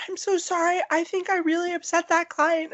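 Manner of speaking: high-pitched mock-contrite teenager; retold worried apology. Teen worker confesses upsetting client to manager, high apologetic. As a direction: plead it high, re-enacting a nervous young employee.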